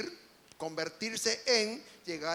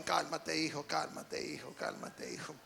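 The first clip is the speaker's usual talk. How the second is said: low, fading, soothing hush; calm the crying boy gently, lower and lower; soothing mother; 8 semitones lower, 7 dB quieter than usual. Retold or imitated. Mother soothing her crying son, low fading hush. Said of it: "¿Cómo se lo dijo?".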